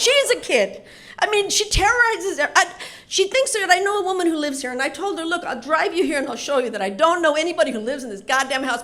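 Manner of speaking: high, loud, rushed pleading; pleading dad. Retold or imitated. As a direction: beg a stranger to believe you.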